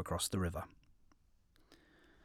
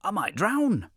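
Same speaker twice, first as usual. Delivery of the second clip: rising high, loud pleading; sly yippy jackal; 5 semitones higher, 5 dB louder than usual. A narrator's reading. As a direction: panicky jackal, climbing higher. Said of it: "said the jackal".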